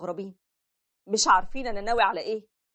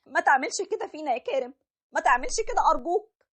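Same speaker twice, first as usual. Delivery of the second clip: high, tearful wife begging him to stay; pleading wife; 8 semitones higher than usual. Acted. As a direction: beg your husband desperately not to divorce you. Wife begging husband not to leave.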